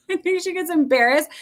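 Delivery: amused, laughing repeat of the same words. Real, not acted. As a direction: laugh it out at her own daughter. Repeat of line 155 through a laugh.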